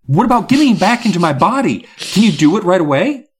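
high, loud pressing questions; impatient demand. A narrator's reading. Gary wanting his body back at once, impatient.

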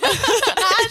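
giggle. Real, not acted. Laughing at friend's story.

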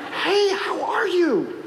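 high, bright mock-cheerful hello; cheery greeting. Pastor greeting a former churchgoer at the gas station, overly cheerful. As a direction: greet her brightly, unaware.

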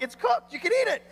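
high, bright offering voice; lightly voiced waiter; cheery hosts. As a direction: coax the guest to eat. Hosts voiced offering pigeon heads.

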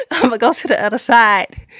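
hammed-up pulpit delivery, full voice; mock preacher call. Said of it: "if I was a preacher, I would say".

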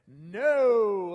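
a loud, blunt shouted no from the front row; really loud no. Retold or imitated. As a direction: blurt it out as loud as you can. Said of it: "like, really loud".

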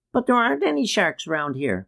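put-on high-pitched child's voice calling back; child shouting back. A narrator's reading. Child contradicting the uncle about sharks, high calling voice. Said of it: "one child shouted back".

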